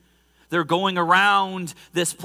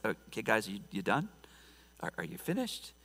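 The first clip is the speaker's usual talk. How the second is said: dry casual mock; 5 semitones lower, 12 dB quieter than usual. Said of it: "He's like".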